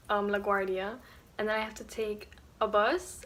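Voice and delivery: ordinary talk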